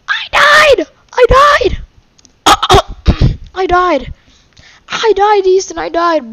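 very high, loud scream, easing slightly; anguished screaming. Real, not acted. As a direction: scream your defeat in a high voice. Just died in the game.

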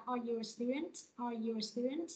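"Are you a student?" rising on "student"; yes-no question tune, up on "student"; rising intonation. Shown as a demonstration. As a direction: lift the end of "student". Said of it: "mình sẽ lên giọng".

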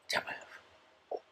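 tense whisper, urgent and low; whispered warning. Retold or imitated. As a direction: whisper it so no one hears. Jamie warning in story.

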